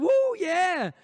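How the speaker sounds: high, loud whooping shout; mock southern crowd hollering out loud; loud southern cheer. Shown as a demonstration. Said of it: "they might get really loud".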